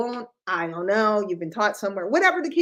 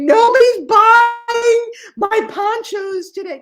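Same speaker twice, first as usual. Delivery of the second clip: louder, higher, slow mock panic; mock panicked wail; 8 semitones higher, 9 dB louder, slower than usual. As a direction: ham up a panicked store owner. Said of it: "are they freaking".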